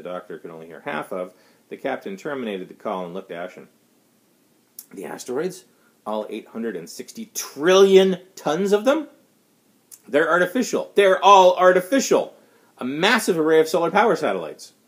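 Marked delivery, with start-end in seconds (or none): 4.9-9.1 stunned disbelief. Acted. Doctor hears shocking asteroid news. 10.1-14.7 a captain reeling from the news; shaken outburst. Acted. Ashen captain reveals asteroids artificial.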